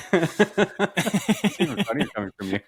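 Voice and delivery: slow, laughing through words. Real, not acted. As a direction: say it while laughing. Laughing at the joke.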